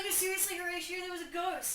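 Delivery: pleading with a doubter; insistent guards. Retold or imitated. Guards insisting to Horatio the ghost is real. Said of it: "And they're like".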